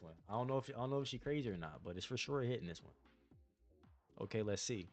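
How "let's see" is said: ordinary talk, nothing marked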